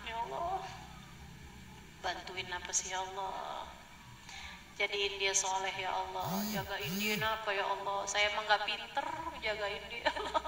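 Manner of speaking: soft, everyday talk to God; a tired parent confiding softly; casual heartfelt prayer. Shown as a demonstration. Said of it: "Minta, sampaikan". (doa santai yang lirih dari orang tua)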